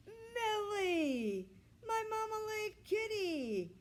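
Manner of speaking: high, loud overjoyed cry of reunion; joyful cry. A narrator's reading. Grandpa crying out on finding his lost cat, high and loud. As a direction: cry out her name with delight. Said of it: "Grandpa cried".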